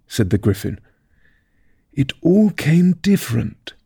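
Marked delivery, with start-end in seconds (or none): 1.9-3.7 thoughtful. Acted. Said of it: "repeated thoughtfully".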